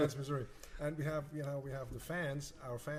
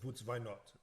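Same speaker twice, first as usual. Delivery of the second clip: put-on Russian accent, w said as v; lightly imitating a Russian pianist's accent; 5 semitones lower, 7 dB quieter, slower than usual. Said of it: "she has this really Russian accent".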